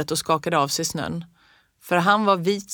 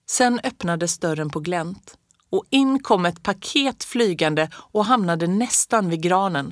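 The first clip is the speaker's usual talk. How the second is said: ordinary delivery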